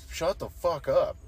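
retold exasperation, words stretched out; irritated. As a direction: slowly plead for silence, fed up. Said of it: "it goes from irritating".

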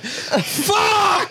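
sudden high shout. Acted. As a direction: yelp it out. Acting coffee jolt.

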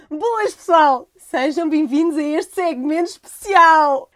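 slow, high, loud welcome; slow hyped host intro. Real, not acted. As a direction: announce it like a show host. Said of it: "vou ler só". (apresentador entusiasmado e lento)

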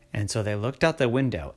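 ordinary delivery

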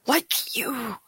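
whispered, mock-ominous echo; dramatic whisper. Echoing the alien's "not like you" line, dramatic whisper.

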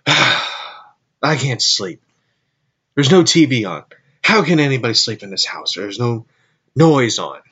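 loud, exasperated retold dad rant; mock outburst of a fed-up dad; imitating dad's complaint. Dad complaining in the night about no TV, exasperated.

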